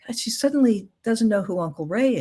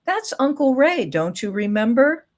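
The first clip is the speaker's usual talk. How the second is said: pointed reminder, starting high and ending on a rising question; mock tactless prompting; less gentle correction; 6 semitones higher, 4 dB louder than usual. Shown as a demonstration. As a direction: correct them as if they ought to know. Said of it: "a much gentler way of saying it than saying".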